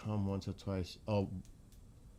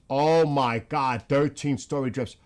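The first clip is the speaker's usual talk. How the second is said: loud amazement; 3 semitones higher, 9 dB louder than usual. Real, not acted. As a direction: exclaim in disbelief. Reacting to giant drifts footage.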